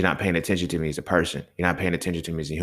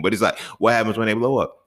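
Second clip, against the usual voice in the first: ordinary talk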